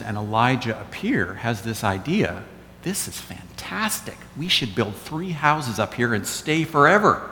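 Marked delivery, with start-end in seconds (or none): 2.8-7.2 high, wonder-struck excitement, held soft; naive enthusiasm. Retold or imitated. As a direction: play the eager disciple with a big idea. Acts out Peter's naive excited idea.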